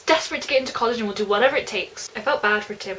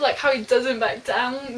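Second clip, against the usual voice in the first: ordinary talk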